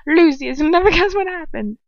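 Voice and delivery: high, loud, laughing rush of news; breathless excitement. A narrator's reading. Bellatrix bursting in to Lucius, breathless. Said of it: "Bellatrix says breathlessly".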